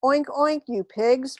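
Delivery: cat insults the aliens with a screech. A narrator's reading. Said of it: "Purr screeched".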